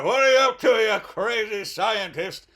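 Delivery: loud, high hammed-up cop; mock-outraged shout at a suspect; accusing police. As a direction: holler it like a cartoon policeman. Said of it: "the police come, and they're like".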